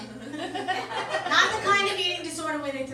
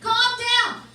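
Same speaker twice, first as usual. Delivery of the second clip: sarcastic, higher and louder; mocking the friend's calm down, louder; 7 semitones higher, 10 dB louder than usual. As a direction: throw his words back at him. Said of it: "the perfect thing to say to a woman who's angry".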